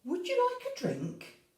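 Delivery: high lilting hostess voice; mum's sweet question. A narrator's reading. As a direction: offer the drink like a kind mum. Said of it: "So Sophie's mummy said".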